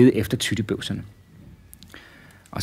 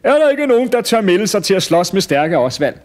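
high, loud challenge to the crowd; announcer calling out; 9 semitones higher, 5 dB louder than usual. Acted. Showman seeking a challenger for Osvald, high loud call. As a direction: call out for a volunteer. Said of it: "da manden så igen sagde".

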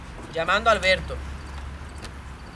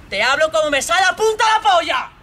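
high, loud outburst building to a yell; furious shout; 5 semitones higher, 5 dB louder than usual. Acted. Boyfriend snapping at Pamela over his tone, furious shout. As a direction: explode and shout it through.

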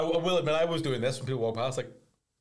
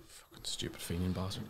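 unvoiced whispered curse, much softer; whispered insult; 7 semitones lower, 9 dB quieter than usual. Real, not acted. Co-host whispers an insult at his friend, whispered. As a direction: hiss the insult under your breath.

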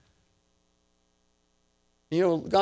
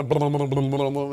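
babbled rolling "blum-blum" sound, louder; imitated tumbling noise; 5 dB louder than usual. Grandpa telling of kids rolling downhill, playful tumbling noise. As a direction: run the blums together like bodies tumbling.

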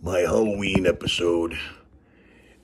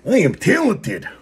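boastful put-on voice; 10 semitones higher, 7 dB louder than usual. Keeps boasting in costume voice.

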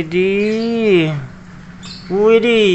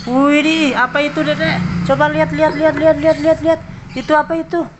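excited; 9 semitones higher, faster than usual. Real, not acted. Showing toy to child viewers.

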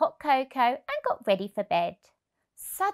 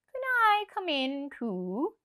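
whispery fox; 6 semitones higher, slower than usual. A narrator's reading. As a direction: ask in a sly hush. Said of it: "he asked".

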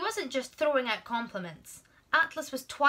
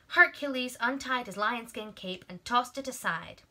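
ordinary delivery

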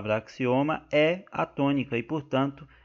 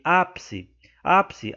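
ÁPICE alto e forte na primeira sílaba; tônica no início; 5 semitones higher, 5 dB louder, much slower than usual. Shown as a demonstration. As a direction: dizer ÁPICE acentuando bem o Á inicial. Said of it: "no acento agudo". (ÁPICE com ênfase na primeira sílaba)

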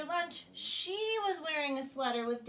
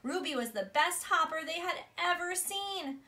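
ordinary delivery, nothing marked

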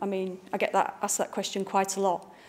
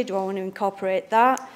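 ordinary talk

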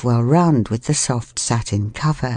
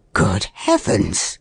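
slower, lower man's exclamation; startled man; 6 semitones lower, slower than usual. A narrator's reading. Man finds a stranger in his bed, startled. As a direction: let it out low and slow, taken aback. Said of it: "It was a man's voice".

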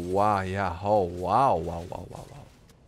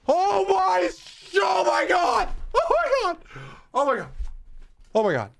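high screaming oh my god, real shock; 3 dB louder than usual. Real, not acted. Gamer screaming in shock at the game. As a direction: scream in disbelief at the screen.

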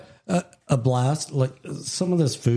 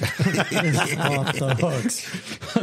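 laughing; 4 semitones higher, much slower than usual. Real, not acted. Laughing while praising the drink.

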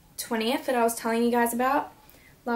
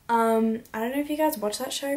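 usual speaking voice

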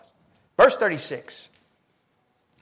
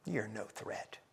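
dismissive; 4 semitones lower, 15 dB quieter, slower than usual. Acted. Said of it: "That's the emphasis".

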